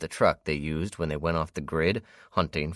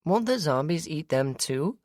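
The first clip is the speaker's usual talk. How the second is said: put-on worried little girl; 13 semitones higher than usual. A narrator's reading. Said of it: "a worried look on her face".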